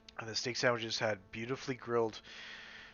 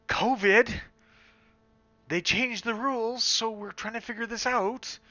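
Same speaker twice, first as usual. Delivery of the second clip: exasperated retelling; 11 semitones higher, 7 dB louder than usual. Retold or imitated. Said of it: "I'm like".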